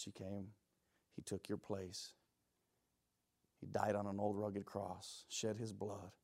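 soft, low, subdued preaching; quiet, reverent reassurance; hushed sincerity. Real, not acted. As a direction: bring it right down, gently earnest. Preacher turns to grace after judgment.

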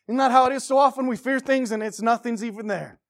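lifted sermon voice, pitched high; emphatic preaching. Real, not acted. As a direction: press the point loudly, easing at the end. Preaching rises in force.

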